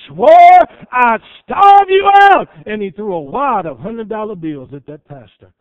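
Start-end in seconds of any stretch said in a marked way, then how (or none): 0.1-2.5 high, slow, loud hammed-up shout; a slow, much higher and louder acted cry; retold yell. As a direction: bellow it like a broken man confessing. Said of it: "he screamed and yelled".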